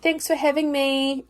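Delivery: put-on a grown child still thanking the parents, in a high sing-song; sing-song thank-you. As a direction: recite it like a well-trained kid. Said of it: "it was just that".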